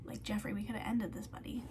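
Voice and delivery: fast whisper aimed at Jeff; whispered aside. Acted. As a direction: hush it like a private dig. Aside scolding the killer.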